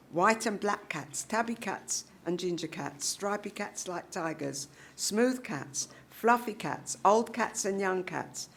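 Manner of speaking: plain reading voice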